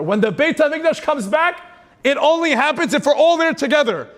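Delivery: rally the congregation at full force; fiery preaching. Acted. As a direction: climax of the sermon, calling out passionately.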